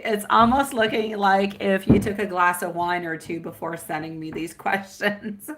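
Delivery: ordinary talk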